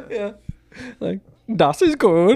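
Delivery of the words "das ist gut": loud, high hammed-up German; mock German accent. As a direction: bark it like a cartoon German. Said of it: "like, a German guy".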